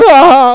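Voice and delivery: loud, high whooping yell; joyful yell. Retold or imitated. Sponsor speeding across the lake at night, loud joyful whoop. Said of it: "like this".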